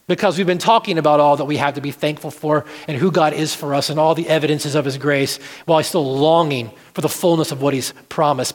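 normal talking voice

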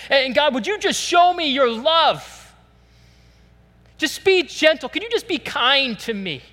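high, loud pleading sermon; fervent preaching. Acted. Pastor voicing a sinner's prayer, fervent preaching.

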